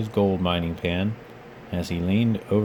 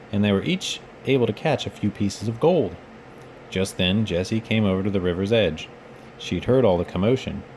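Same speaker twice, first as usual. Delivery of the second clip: ordinary delivery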